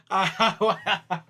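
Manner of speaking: laughing, unable to stop. Real, not acted. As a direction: burst out laughing mid-word. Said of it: "I can't stop".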